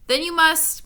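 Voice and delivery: high gasped reply; a touch of a frightened gasp. A narrator's reading. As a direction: catch your breath as you say it. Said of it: "gasped Milo".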